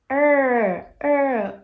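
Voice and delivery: "er" loud, high and drawn out; long falling English "er", demonstrated; voiced R sound. Shown as a demonstration. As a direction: sound out "er, er" with the vocal cords buzzing. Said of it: "usa a corda vocal".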